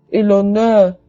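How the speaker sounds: ordinary talk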